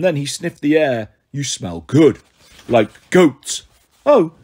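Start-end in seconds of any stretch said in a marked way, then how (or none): 1.3-3.6 lightly growled Cyclops voice; a hint of a hungry giant; monster voice. Cyclops voice in story. 4.0-4.3 high startled oh; nervous oh. Acted. Grover nervous gasp.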